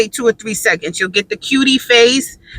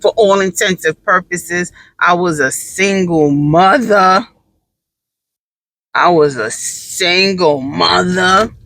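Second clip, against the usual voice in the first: for all intensive purposes, I was a single mother drawn out slowly; slow emphatic saying; 3 semitones lower, slower than usual. Shown as a demonstration. Mom telling viewers her new saying, slow and emphatic. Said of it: "That's my new saying".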